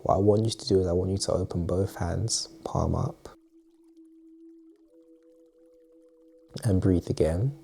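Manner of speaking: calm meditation guide, long pauses between phrases; unhurried, quietly spoken breathing exercise; guided meditation. Acted. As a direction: ease the listener gently into relaxation, softening at phrase ends.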